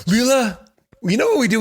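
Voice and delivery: lightly voiced cheerful dad. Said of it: "It's just like".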